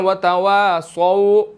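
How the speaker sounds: तवासौ with the final vowel held a second; long madd. Shown as a demonstration. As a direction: stretch तवासौ slowly at the end. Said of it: "one second लंबा करेंगे". (तवासौ का आख़िरी स्वर लंबा)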